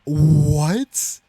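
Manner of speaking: loud, high, drawn-out shocked "what"; stunned cry of disbelief. Real, not acted. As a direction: gasp it out in disbelief. Shocked by friend's result.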